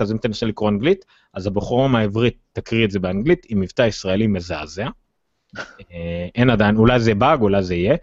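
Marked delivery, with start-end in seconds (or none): none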